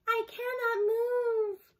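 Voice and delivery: a robot mimicking a wounded calf. A narrator's reading. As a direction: mimic a hurt calf crying for help. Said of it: "The calf's voice continued crying out".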